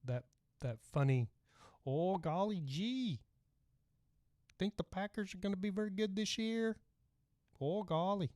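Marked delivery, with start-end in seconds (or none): 1.8-8.3 put-on Green Bay Wisconsin accent. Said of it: "they really do have that, uh, that, that funny".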